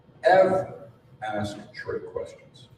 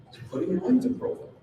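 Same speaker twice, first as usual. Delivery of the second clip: usual speaking voice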